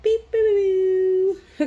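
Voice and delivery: high, loud silly beep-boop noises; goofing off with a squeaky robot beep. Real, not acted. Playing around mid-reading, making loud high beep-boop noises.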